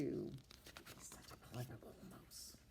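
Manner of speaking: whispered slowly, admiringly; storyteller whisper. Acted. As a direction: confide it to the kids in a hush. Reading aloud to children.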